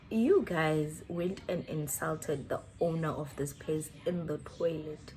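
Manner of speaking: lightly acted grave bouncer; retold bouncer. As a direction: deliver it low and measured, as a bouncer. Voicing bouncer's grave explanation.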